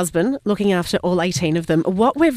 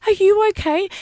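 put-on much higher, concerned child voice; sweet little kid; 11 semitones higher than usual. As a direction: ask it like a worried small boy. Sweet child checks on her.

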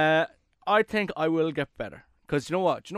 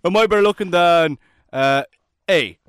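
much louder, mock-insistent questioning; imitating Nathan; 9 dB louder than usual. Nathan pestering him about who's better looking, hammed-up loud nagging. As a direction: badger him like a needy friend. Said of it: "Nathan goes to me".

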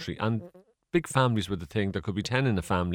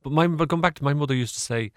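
usual speaking voice

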